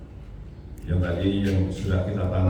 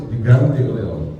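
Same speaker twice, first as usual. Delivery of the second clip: usual speaking voice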